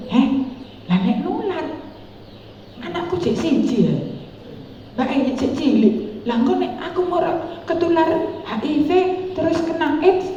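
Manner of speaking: high, hushed, worried thoughts; a mother fearing infection for her young children, high and anxious; panicked inner voice. Retold or imitated. Think it in a panicked, high hush. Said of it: "Aku batin".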